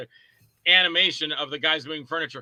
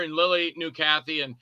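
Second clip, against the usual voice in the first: ordinary talk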